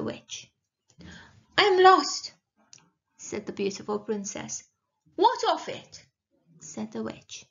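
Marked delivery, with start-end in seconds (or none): 1.6-2.3 high, loud princess; demanding princess. A narrator's reading. Princess announces her plight. 5.2-6.1 high-pitched dismissive witch retort. A narrator's reading.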